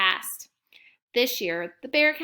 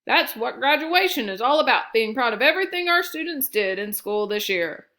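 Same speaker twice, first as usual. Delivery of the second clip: louder, proud speechmaking voice; proud speaker; 5 dB louder than usual. A narrator's reading. Adult to the family about graduation, louder and proud. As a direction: proclaim it proudly.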